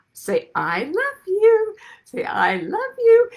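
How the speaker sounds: put-on high, slow coaxing baby talk; mother cooing to her baby, drawn out. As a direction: coax a baby to say its first words. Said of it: "saying to the baby".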